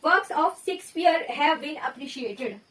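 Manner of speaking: very slow, word by word; slow teacher dictation. Shown as a demonstration. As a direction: read it out slowly for the class. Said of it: "और दूसरा sentence क्या है".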